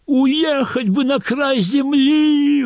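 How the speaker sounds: high, slow, drawn-out wail. Acted. Polina wailing her longing at the rehearsal, high and slow. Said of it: "выла Полина".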